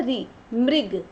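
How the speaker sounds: री drawn out slowly, then मृग; slow demo of री. Shown as a demonstration. Teacher sounding out री for students, slowly. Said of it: "इसको री पढ़ते हैं".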